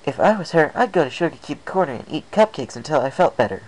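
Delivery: high, bubbly pony voice; chirpy, bouncy high voice; Pinkie Pie voice. Acted.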